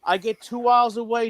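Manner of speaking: ordinary delivery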